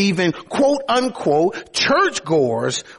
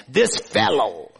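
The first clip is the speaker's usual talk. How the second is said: dismissive; 3 semitones lower, slower than usual. Acted. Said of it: "They referred to him as".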